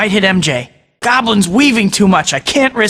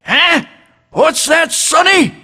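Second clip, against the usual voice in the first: high, creaky mock deaf old man; mocking an old villain as a deaf geezer; 9 semitones higher, slower than usual. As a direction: cup an ear like a deaf grandpa. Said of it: "you'll get bugs in your dentures".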